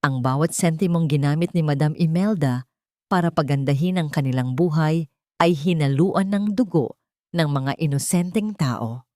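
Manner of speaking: plain reading voice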